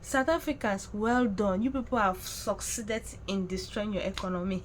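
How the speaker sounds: ordinary delivery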